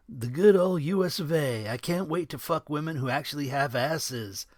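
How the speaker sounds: a lightly voiced crude buddy; crude soldier Coop. A narrator's reading. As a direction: crude and gleeful about going home.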